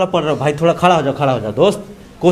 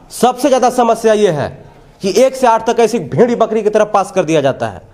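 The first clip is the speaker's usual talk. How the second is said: pitched-up, insistent reporting delivery; TV reporter's tirade; 4 semitones higher than usual. Real, not acted. Reporter blames officials for failing schools, in insistent report style. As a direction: hammer the complaint home to the viewers. Reporter's indignant piece to camera.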